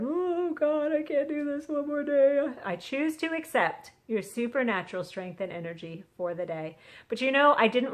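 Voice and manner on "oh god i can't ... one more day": high, mock-despairing wail; hammed-up exhausted plea to God; tired moan. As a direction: groan it upward like someone worn out.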